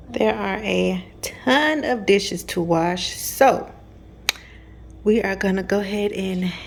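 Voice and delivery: slow breathy whisper; hushed voiceover about the dishes. Real, not acted. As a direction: whisper it slowly like narrating at night.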